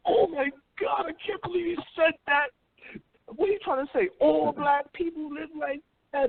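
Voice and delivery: high, hammed-up shocked wail; a hint of fake tears; mock outrage. Mocking outraged reaction to Trump.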